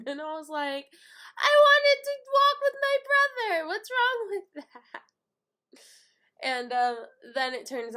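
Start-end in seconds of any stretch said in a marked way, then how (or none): 1.4-5.0 high, loud wailing protest; hammed-up little-kid whine; childish tantrum. Sister having a fit before parents, tantrum wail.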